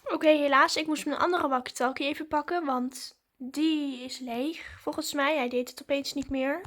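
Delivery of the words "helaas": ordinary delivery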